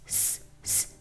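ordinary talk, nothing marked

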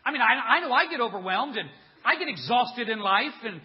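emphatic, astonished preaching; incredulous. Acted. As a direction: exclaim in disbelief about a fallen hero. Contrasts himself with his disbelieved hero.

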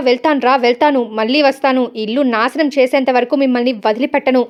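ordinary delivery

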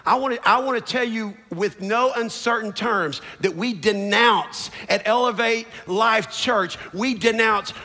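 impassioned declaration building to a shout; thundering, high-pitched pulpit proclamation; full-force preaching. Real, not acted.

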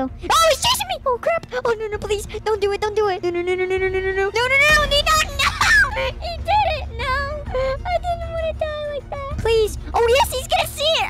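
panicked high shrieking; frantic, terrified yelling; scared horror game streamer. Real, not acted. Chased by killer in game.

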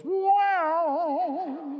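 high, loud cartoon "wow"; like a cartoon whose eyeballs pop out. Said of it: "See those cartoons?".

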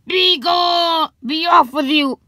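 high, loud witchy dismissal; shooing shout. A narrator's reading. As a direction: shout them off your property. Witch lady chases the girl away.

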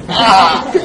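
loud, laughing startled shout; sudden loud laughing cry when the light flashes on; startled yelp. Retold or imitated. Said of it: "I was like".

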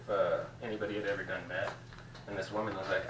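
ordinary talk

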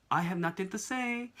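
high, sing-out delivery, drawn out; playful announcement. Acted. Parent to kids listening closely, playful announcement. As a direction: pitch it up as a teasing letdown.

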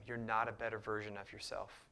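ordinary delivery

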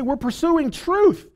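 high, slow, proud claim; a high, slow mock boast; mock self-righteous claim. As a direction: sing it out like a smug believer. Mock quote of self-deceived people.

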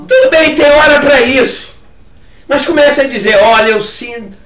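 high, loud exhortation; forceful preaching. Real, not acted. Pastor urging congregation to speak out online, forceful preaching. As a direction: urge listeners firmly into action.

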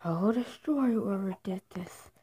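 usual speaking voice

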